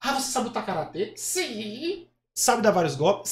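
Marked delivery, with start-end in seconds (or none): 1.1-2.1 high puppet voice; drawn-out high dummy answer; Kleber. Acted. Puppet boasting of karate, high puppet voice. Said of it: "acha uma voz que pareça com o boneco".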